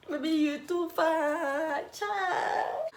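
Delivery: high, slow, sing-song praise; a man drawling praise at a girl who won't bath; drawn-out flattery. Retold or imitated. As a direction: stretch it out to flatter her.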